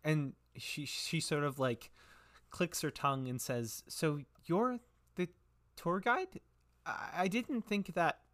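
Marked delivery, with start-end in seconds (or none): 3.9-8.2 put-on high, flustered little-girl voice; little girl shopkeeper. Twitch realises he guides the town, high flustered girlish voice. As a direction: work it out aloud as an excitable girl.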